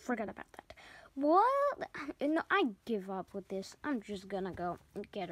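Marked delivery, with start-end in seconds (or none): 0.0-0.7 whispered embarrassed aside. Real, not acted. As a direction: whisper it to yourself.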